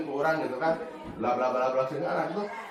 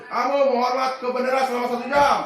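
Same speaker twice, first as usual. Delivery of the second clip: higher and louder, a harsh sentence; stern punishing order; 8 semitones higher, 6 dB louder than usual. Shown as a demonstration. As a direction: punish the late pupil sternly. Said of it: "Kalau Anda sebagai penghukum". (perintah keras menghukum)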